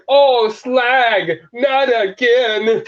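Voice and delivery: high, drawn-out cartoon wail; mock dismay. Fan retelling a mid-air crash, high mock dismay. Quoting Blitzwing about to crash.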